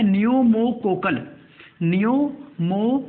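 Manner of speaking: "Pneumococcal" slowly, broken into parts; slow dictation. Shown as a demonstration. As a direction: spell out "pneumococcal" by sound, part by part for note-takers. Dictating vaccine name for note-taking.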